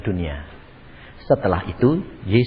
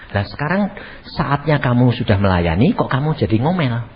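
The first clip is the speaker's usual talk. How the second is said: ordinary delivery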